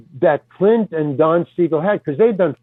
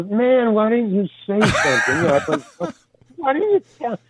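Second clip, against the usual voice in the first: high mock protest; 6 semitones higher than usual. Protesting to director.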